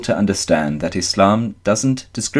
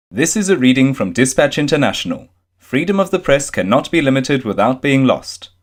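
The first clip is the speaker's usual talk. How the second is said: higher, bright announcer promo; promo read; 4 semitones higher than usual. Acted. Reading the publication promo to listeners, announcer read. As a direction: sell the publication like a station ID.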